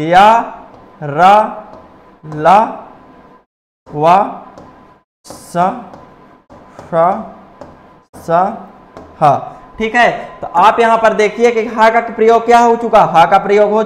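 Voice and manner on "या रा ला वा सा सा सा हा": या रा ला वा सा हा each said alone as a clear, drawn-out teaching demo; chanted letter drill; syllable recital. Shown as a demonstration. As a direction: recite each sound separately, pausing between them. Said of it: "अब अंतस्थ कौन कौन से होते हैं?".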